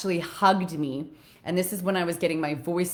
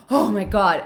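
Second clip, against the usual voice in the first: mock shocked exclamation; 3 semitones higher, 9 dB louder than usual. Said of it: "And he goes".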